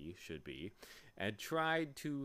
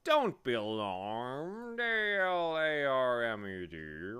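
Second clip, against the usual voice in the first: slow, louder bee with drawn-out sounds; spelling bee; 4 semitones higher, 9 dB louder, slower than usual. A narrator's reading. Bee reassuring a startled boy, slow drawn-out creature voice. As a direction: stretch the words out like a big bee.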